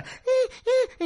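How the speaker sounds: very high little cat cry; high cat squeal; she-cat protest. Acted.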